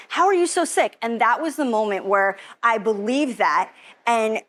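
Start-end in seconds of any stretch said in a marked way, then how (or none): 0.1-1.0 high, baffled question falling at the end; puzzled doctor, repeated. Retold or imitated. Doctor repeating his question, high and baffled, falling. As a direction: repeat it, still stumped, letting it drop. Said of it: "being like".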